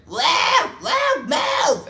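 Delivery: very high, loud shriek at someone; screaming. Retold or imitated. Sleepwalking mom screams at absent little brother, shrieking. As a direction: scream it full out, imitating a sleepwalker. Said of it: "she starts screaming at".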